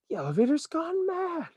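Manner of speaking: very high, strained alarm; panicked shout. A narrator's reading. Grandpa Joe high in the sky, panicked. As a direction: cry out in panic. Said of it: "shouted Grandpa Joe".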